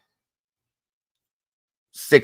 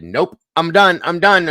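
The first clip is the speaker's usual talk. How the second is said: higher, louder retold cry of Nope, I'm done; panicked refusal; 8 semitones higher, 6 dB louder than usual. Locked into the roller coaster, shouting to get off in panic. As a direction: relive begging to get off the ride. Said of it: "I said".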